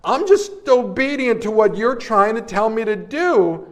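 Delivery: dramatised desperate appeal; pleading prayer. Retold or imitated. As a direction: act out protesting to God, pitch climbing. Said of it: "I'm praying. I'm looking up going".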